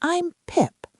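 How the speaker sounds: high, shy little sprout; small shy voice. A narrator's reading. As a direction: say it timidly, tiny. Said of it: "in this small, shy voice".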